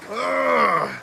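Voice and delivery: high grunt of pain; pained groan. Retold or imitated. Patient forced to sit up after surgery, pained groan. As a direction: strain as if sitting up sore.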